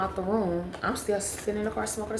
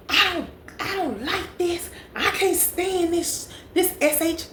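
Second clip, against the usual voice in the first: high-pitched imitation of a loud complainer; put-on high, slow rant for all to hear; loud complaining; 10 semitones higher, slower than usual. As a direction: mimic her griping, drawn out and high. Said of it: "y'all, she was talking loud".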